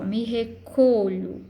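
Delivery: "me recolho" slowly, with a strong CO in recolho; tônica no co. Shown as a demonstration. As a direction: hit CO in "recolho", let lho fade. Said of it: "o som forte ficou aqui no co". ("me recolho" devagar, com o co bem forte)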